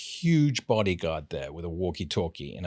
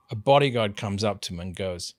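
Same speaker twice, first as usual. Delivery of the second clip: ordinary talk, nothing marked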